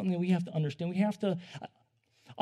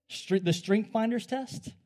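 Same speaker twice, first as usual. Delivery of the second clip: ordinary talk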